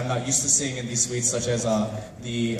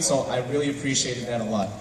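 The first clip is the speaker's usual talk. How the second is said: ordinary talk, nothing marked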